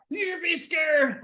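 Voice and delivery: the fear monster warning everyone, slow and eerie; fear guy warning. Retold or imitated. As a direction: draw it out to scare them.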